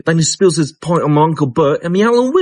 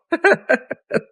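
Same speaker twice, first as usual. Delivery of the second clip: laugh. Real, not acted. Laughing at his own story.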